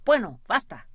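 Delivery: curt, impatient "enough"; impatient interruption. A narrator's reading. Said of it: "interrumpió el jefe".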